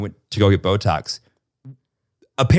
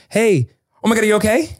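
pitched-up worried mom voice; a light imitation of a panicky mom; 10 semitones higher than usual. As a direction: fuss like an anxious mother. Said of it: "it's like a mom".

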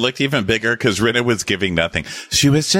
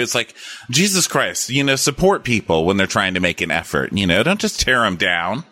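ordinary talk

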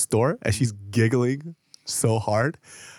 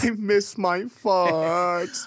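mock sad; 7 semitones higher, much slower than usual.

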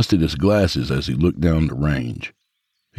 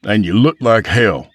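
raised-pitch, stern scolding; scowling rebuke; 6 semitones higher, 5 dB louder than usual. Acted. Golfer confronts drunk colleague, scowling rebuke. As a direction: tell him off with a scowl. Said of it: "Dennis scowled".

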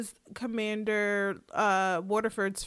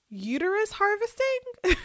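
high, incredulous repeat of the phrase; can't believe what she just read; genuinely taken aback; shocked disbelief; 10 semitones higher than usual. Real, not acted. Stunned by show's plot twist.

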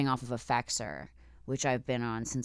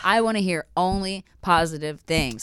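mock demand; 5 semitones higher, 6 dB louder than usual. Repeating the rule for gloomy dates, mock demand. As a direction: spell out the rule with playful force.

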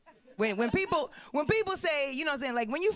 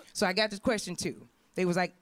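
normal talking voice

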